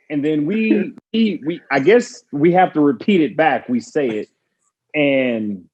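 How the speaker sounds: ordinary delivery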